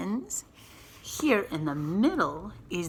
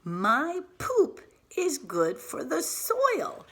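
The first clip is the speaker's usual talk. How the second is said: slow, higher-pitched worm character; lightly voiced worm; 9 semitones higher, 4 dB louder, slower than usual. A narrator's reading. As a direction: read it as a cheeky worm's announcement, drawn out. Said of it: "Now the worm is telling us".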